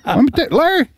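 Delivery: very high cartoonish politician voice; Ross Perot. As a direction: pitch it way up, mock-insistent. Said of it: "and he would say".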